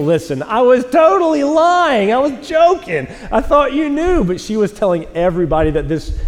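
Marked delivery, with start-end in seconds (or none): 0.0-4.2 higher, slow, apologetic owning up; sheepish confession. Retold or imitated. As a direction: confess the joke sheepishly, pitched up. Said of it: "I had to tell Rachel".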